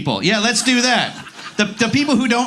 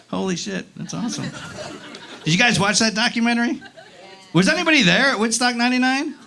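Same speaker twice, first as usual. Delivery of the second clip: usual speaking voice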